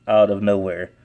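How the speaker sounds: ordinary delivery, nothing marked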